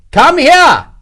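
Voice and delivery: high, loud impatient order; irritable shout. A narrator's reading. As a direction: bellow the order at your lieutenant. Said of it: "Morris shouted irritably".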